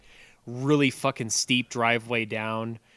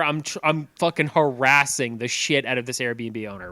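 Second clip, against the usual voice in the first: ordinary talk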